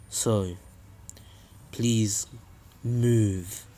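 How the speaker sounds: low, quiet, menacing villain voice; low, soft voice for Pain facing Jiraiya; Pain's voice. Retold or imitated. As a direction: brood coldly in a low murmur. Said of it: "Pain replies by saying".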